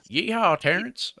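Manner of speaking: put-on high, loud cowboy Southern US accent hollering; Southern yeehaw. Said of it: "I don't know why they're all Southern".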